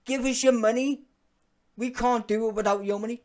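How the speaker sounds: mocking online hosts who beg viewers for money; mock begging streamer. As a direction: whine like a streamer begging for donations. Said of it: "beg you for your money".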